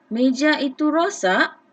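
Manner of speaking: sung-out question intonation; questioning rise. Shown as a demonstration. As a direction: lift the end like a real question. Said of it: "intonasi suara kita pun, okey, ada lenggok dia".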